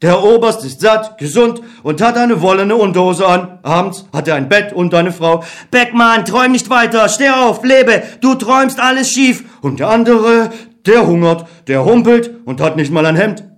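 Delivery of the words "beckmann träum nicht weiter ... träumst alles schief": higher, urgent inner voice; optimistic Other. Acted. The Other urging Beckmann to trust people. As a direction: coax him to believe.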